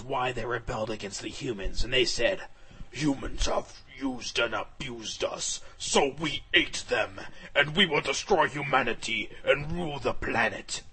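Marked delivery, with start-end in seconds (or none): 2.9-10.8 menacing dragons. Acted. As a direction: growl out a threat to humanity. Rogue dragons explain their rebellion.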